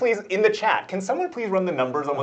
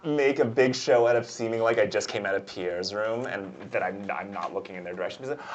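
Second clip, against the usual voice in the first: ordinary delivery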